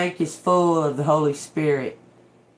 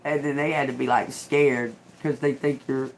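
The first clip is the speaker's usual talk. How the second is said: ordinary delivery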